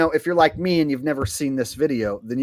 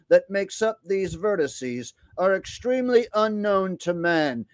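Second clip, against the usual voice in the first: imitated robot voice, higher and measured; Stephen Hawking; 4 semitones higher than usual. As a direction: recite it like a speech computer. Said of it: "have the robot voice do the speaking".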